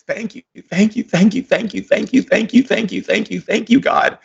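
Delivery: rising, fervent repeated thank yous; ecstatic thanks. Retold or imitated. Shaman reliving his morning shower, gushing thanks to God. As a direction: pour out thanks like a rapture.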